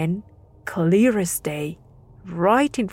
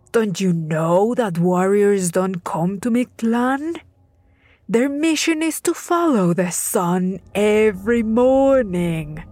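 stern god. Acted. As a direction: pronounce his doom. Death god condemns the boy.

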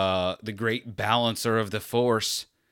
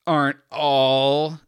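slow, louder, high smug drawl; sarcastic confidence; 6 semitones higher, 7 dB louder, much slower than usual. Retold or imitated. Podcaster quoting the annoying phrase with smug drawl. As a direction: stretch it out with fake certainty. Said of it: "sarcastic confidence".

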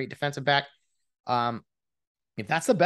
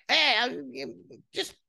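high, mocking trash-talk voice; courtside trash talker; 12 semitones higher than usual. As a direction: needle the player from the sidelines. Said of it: "he's talking all the smack".

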